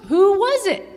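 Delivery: loud, high dramatic whoa; excited. Acted. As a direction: burst out with the reveal. Building to big reveal.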